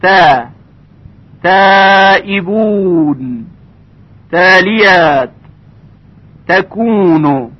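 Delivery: slow recitation with thin, light ت in تائبون and تکونو; light tarqiq. Shown as a demonstration. Tajwid teacher giving examples of ت, light tarqiq. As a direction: draw out تائبون with a fine ت and fronted æ.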